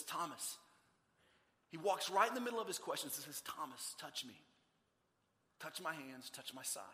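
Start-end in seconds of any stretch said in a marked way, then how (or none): 0.0-0.6 soft, falling call of the name; gentle call. Acted. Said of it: "No, he walks over to Thomas and he says". 3.4-6.8 very soft, low, tender voice; gentle invitation. Acted. Jesus gently invites doubting Thomas.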